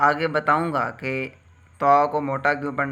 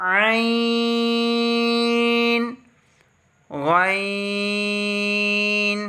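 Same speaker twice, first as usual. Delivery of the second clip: slow chant on a raised, steady note, each letter held; alphabet recitation; 5 semitones higher, 7 dB louder, much slower than usual. Shown as a demonstration. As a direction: recite each letter long and clear for repetition. (क़ारी की तरह हुरूफ़ खींचकर तिलावत)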